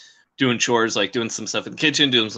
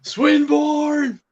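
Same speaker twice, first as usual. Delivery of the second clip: high, drawn-out holler of a name; playful yell; 13 semitones higher than usual. Pretend. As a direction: yell it out just for the fun of it. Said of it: "It's a fun name to yell".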